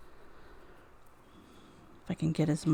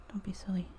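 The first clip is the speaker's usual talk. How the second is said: whispered scolding; 2 semitones higher, 8 dB quieter, much faster than usual. Real, not acted. Scolding the wire.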